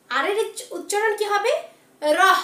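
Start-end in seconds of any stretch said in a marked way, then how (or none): 2.0-2.4 রহ said loud and low, cut off at the end; hasanta ending. Shown as a demonstration. Teacher models the letter R for pupils, রহ with hasanta ending. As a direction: stop রহ on a breathy h. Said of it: "যেহেতু হসন্ত আছে".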